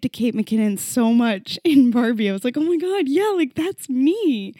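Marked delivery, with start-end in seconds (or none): 2.5-4.5 high-pitched thrilled identification; gushing, pitched well up; excited recognition. Retold or imitated. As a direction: relive the moment of seeing yourself. Retelling her delighted recognition at film.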